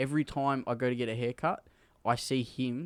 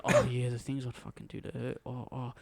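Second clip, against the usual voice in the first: quiet muttering; 8 dB quieter than usual. Retold or imitated. Barber voiced muttering again.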